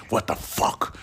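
hushed, stunned whisper; whispered disbelief. Real, not acted. Ranting host to his audience, stunned whisper.

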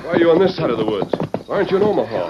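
high, loud urgent questions; calling out. Acted. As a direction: rein in and call across. Reining in, calling to girl.

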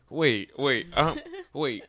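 ordinary talk

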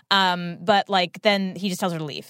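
ordinary talk, nothing marked

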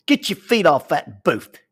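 mock shout from another table; retold yell. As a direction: call out the command like a stranger scolding. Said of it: "this voice in the restaurant yells".

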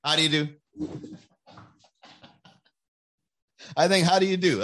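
a prim, breezy how-do-you-do repeated; mock polite greeting. Shown as a demonstration. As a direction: tip the hat and walk past. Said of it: "I think that's a good one".

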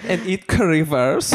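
drawn-out camp German; camp German accent. Pretend. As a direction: savour it in a playful German accent.

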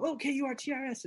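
flustered, intimidated. Retold or imitated. Starstruck kid spelling his name for his idol, flustered. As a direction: blurt the letters like an intimidated child. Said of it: "I was so intimidated".

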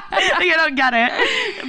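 slow, laughing through words. Real, not acted. Laughing at confusion.

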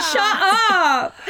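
put-on high, loud teenage shut up; mock shout. Sisters retelling yelling at dad, mock teenage shout. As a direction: yell it back at dad like a teen.

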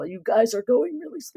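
pain voice. Retold or imitated. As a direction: groan it like a bored zombie trooper. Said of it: "You're doing the voice again".

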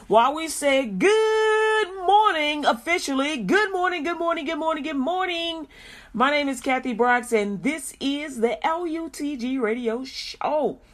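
loud drawn-out greeting easing into the station ID; radio show opener. Real, not acted. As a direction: belt out the station welcome, stretching the key words. Opens the radio show as announcer.

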